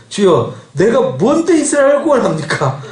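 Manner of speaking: acted-out reluctant protest; protesting Gideon. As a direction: plead that you are a nobody. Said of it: "기드온이 대답하되".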